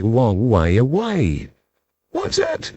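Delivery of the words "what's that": much higher, softer tiny voice; a tiny far-off creature calling up. Acted. Tiny Mister Small cries out, high and soft. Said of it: "it cried".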